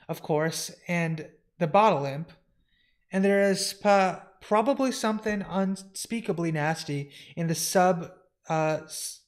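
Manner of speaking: halting, stumbling comic-book character; hesitant stammerer. Acted. Flustered housekeeper listing the house's residents, hesitant stammer. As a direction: stammer through it with nervous ums and stops.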